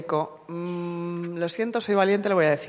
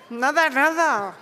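mock mysterious; 7 semitones higher, 3 dB louder, slower than usual. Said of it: "Y dicen".

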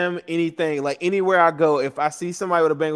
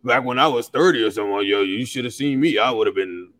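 imitating old-timer; 7 semitones lower than usual. Aging fan bragging about his thirties, low old-timer voice. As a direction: lightly put on an old man reminiscing, voice dropped low. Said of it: "old as hell trying to be like".

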